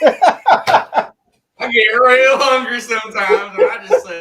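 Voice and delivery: laughing it out, loud and high; real laughter through the words. Real, not acted. Laughing confession about forks.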